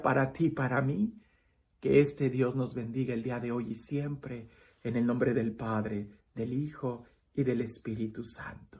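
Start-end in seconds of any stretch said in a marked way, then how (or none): 1.8-8.7 soft, solemn blessing over the listeners; priestly blessing. Acted. Priest blessing his online flock, softly and solemnly. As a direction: bless them gently, like a prayer.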